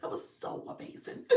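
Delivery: whispered gushing, voice fading into a laugh; breathless whisper. Retold or imitated. Imitating a stunned viewer, awed whisper. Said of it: "it's like".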